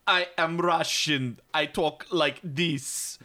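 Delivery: put-on very slow, hammy Russian accent; mock a bad Russian accent, word by word; laboured, drawn-out fake Russian; John Malkovich. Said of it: "doing this Russian accent where he's like".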